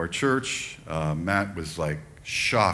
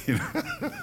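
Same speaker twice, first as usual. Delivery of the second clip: laughing; 6 dB quieter than usual. Real, not acted. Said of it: "I'm just laughing".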